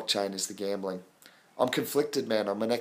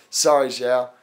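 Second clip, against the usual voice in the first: laughing sorry; 8 dB louder, slower than usual. Real, not acted. Apologising while laughing.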